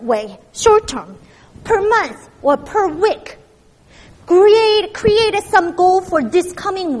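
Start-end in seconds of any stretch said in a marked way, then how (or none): 4.3-7.0 emphatic call. Real, not acted. Sermon exhortation.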